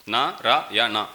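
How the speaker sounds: NARAYANA slow, split into Na, ra, ya, na; syllable by syllable. Shown as a demonstration. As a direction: count out "Narayana" slowly, one beat per syllable. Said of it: "four syllable".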